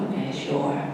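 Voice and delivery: a grave little nod in the voice; serious. Acted. Mikey agreeing to the forest girl's invitation, serious. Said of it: "Mikey nods seriously".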